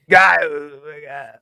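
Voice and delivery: sloppy, slowed-down drunk imitation; drunk slur. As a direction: mimic a wasted soldier dragging his words. Imitating drunk soldier on march.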